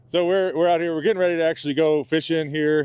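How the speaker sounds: calling out over the wind; shouting over wind. Real, not acted. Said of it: "you can hear me over the wind".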